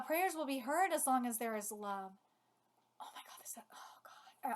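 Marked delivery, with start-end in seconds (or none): 3.0-4.5 choked-up whisper; holding back tears. Real, not acted. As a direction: whisper it, trying not to cry. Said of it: "I'm trying not to cry".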